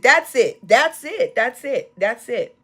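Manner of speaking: repeated "That's it" cried out high; ecstatic affirmation. Real, not acted. Preacher affirming the song to the congregation, ecstatic calls. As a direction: call out "That's it" over and over in rapture.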